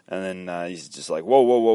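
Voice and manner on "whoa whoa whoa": loud, quick run of whoas; loud objection. Acted. Character heading off judgement, loud interjection. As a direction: cut in loudly to stop them.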